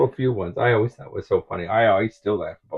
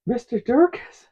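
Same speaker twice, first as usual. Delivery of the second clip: put-on high, loud little-boy voice saying a cheerful threat; high kid voice, much louder on the threat; kid's threat; 9 dB louder than usual. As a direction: play the innocent kid blurting it out. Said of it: "he goes".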